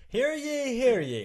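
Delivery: high, loud town crier call. Pretend. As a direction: ring it out like a herald. Mock proclamation to the crowd.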